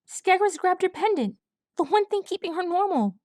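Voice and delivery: high, breathless frightened colt voice; panicked young colt. A narrator's reading. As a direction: blurt it out like a terrified little colt. Colt Swab urging.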